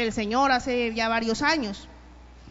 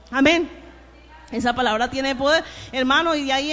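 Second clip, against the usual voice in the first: louder preaching build to the congregation; fervent pulpit preaching, raised voice; 4 dB louder than usual. Acted. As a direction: drive the point home to the brothers.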